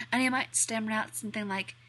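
plain reading voice

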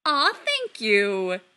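loud, drawn-out, delighted thanks; beaming. A narrator's reading. Mother flattered about her hat, beaming. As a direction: draw it out, beaming. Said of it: "Kim beams".

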